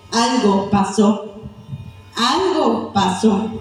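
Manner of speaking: lento, pesado, con peso; lento y solemne. Real, not acted. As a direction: deja caer cada palabra, despacio. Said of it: "pausado y grave". (predicador que repite despacio y grave)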